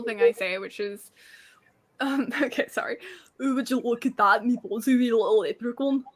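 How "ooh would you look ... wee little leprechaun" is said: put-on Scottish brogue; Scottish accent. Shown as a demonstration. Guest shows hosts her party-piece line in a Scottish accent. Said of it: "give us the Scottish accent".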